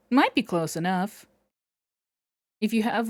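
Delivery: ordinary talk, nothing marked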